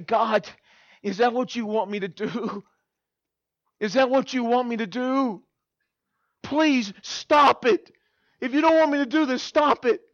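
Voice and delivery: building from a question to a high, insistent plea; increasingly urgent prayer; pleading with God. Retold or imitated. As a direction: beg for an answer, rising in pitch. Retold escalating plea to God.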